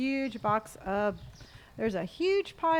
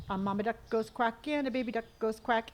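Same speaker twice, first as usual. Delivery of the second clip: ordinary delivery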